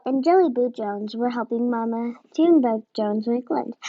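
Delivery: usual speaking voice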